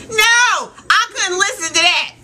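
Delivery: high-pitched, exasperated outburst; emphatic refusal. Real, not acted. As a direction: cut in with a fed-up protest. Emphatic refusal after slow impression.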